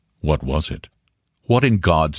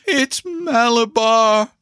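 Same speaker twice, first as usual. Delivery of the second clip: high, drawn-out, possessed scream; powerful strange scream; slower than usual. A narrator's reading. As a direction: stretch the cry out slowly, very high. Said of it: "screamed in a powerful, strange voice".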